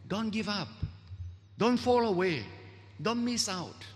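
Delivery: high, pleading warning voice; urgent warning. Acted. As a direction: urge them not to give up. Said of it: "the reader is warned".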